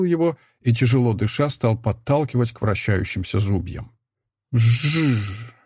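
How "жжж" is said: voiced zh buzz of a sawmill; buzzing saw imitation. A narrator's reading. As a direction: hum like a cutting saw. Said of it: "завизжала пила".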